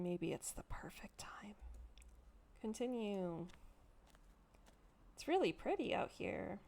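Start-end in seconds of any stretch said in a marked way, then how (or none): 0.0-1.5 whispered dark aside; hint at something sinister, hushed; ominous whisper. Acted. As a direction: narrator whispering a dark suggestion.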